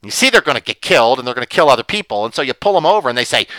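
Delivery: ordinary talk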